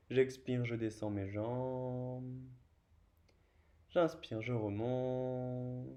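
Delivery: slow breath cues with long held endings; unhurried yoga instructor; yoga guidance. Real, not acted. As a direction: draw the last syllable out for the whole movement. Guides slow breathing and leg lowering.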